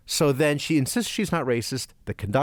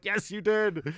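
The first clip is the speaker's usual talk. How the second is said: high-pitched imitation of indignant passengers; mock crowd retort; 7 semitones higher, slower than usual. Whole train answering the woman's denial, mock indignant and high. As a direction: chime in high, as a whole carriage contradicting her.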